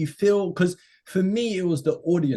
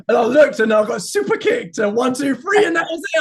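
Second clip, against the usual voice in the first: loud, high, laughing rush of the story; excited; 10 semitones higher, 6 dB louder than usual. Real, not acted. Telling the mates about the kick, excited laughing shout. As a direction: shout it out as the punchline lands. Retelling the surprise attack.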